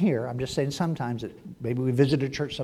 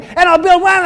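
much higher, loud gushing; eager Peter; 13 semitones higher, 12 dB louder than usual. Retold or imitated. Peter still promising to build, high and loud. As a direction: Peter talking over God, excited. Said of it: "Peter's going".

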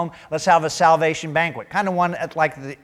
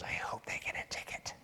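unvoiced spiteful whisper; whispered thought; 19 dB quieter than usual. Driver whispering a petty wish about a speeder. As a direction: breathe it like a petty secret thought. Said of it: "your first thought is".

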